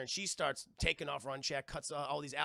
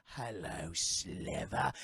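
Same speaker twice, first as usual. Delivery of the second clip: low, slow growling villain voice; slimy villain; 11 semitones lower, much slower than usual. Retold or imitated. Streamer impersonating Ben Mendelsohn greeting the heroine, low villain growl. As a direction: purr it like a slimy lizard villain. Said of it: "slimy salamander-esque villain with a growl".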